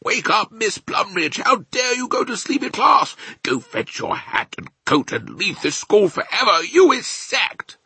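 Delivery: high, loud outraged shout; shouted headmaster's reprimand; angry shout. A narrator's reading. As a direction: bellow a furious scolding. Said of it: "and he shouts".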